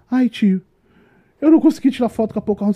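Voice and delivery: put-on whiny teen girl. Guide imitating upset teenage girl, lightly whiny. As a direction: mimic the tearful girl.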